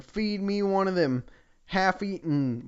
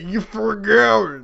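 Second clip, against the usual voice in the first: slow, louder, higher villain line; hammed-up menace; 6 semitones higher, 8 dB louder, slower than usual. Reader redoing the villain line, slow and hammed-up. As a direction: lean into the typo, slowly.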